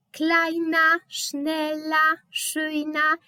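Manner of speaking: Kleina, schnella, schöna ending in an open a; final r read as a. Shown as a demonstration. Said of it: "Czytamy jak A". (końcówka -er czytana jak „a”)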